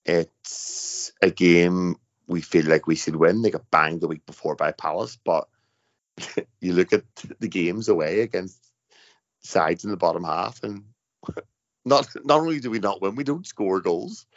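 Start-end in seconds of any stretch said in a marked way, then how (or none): none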